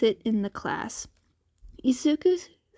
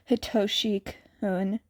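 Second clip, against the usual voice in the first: ordinary delivery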